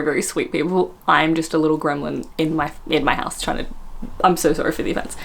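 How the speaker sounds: usual speaking voice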